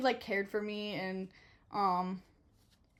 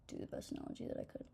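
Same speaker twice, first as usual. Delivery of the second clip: whispered aside; 16 dB quieter, faster than usual. Real, not acted. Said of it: "aside under her breath".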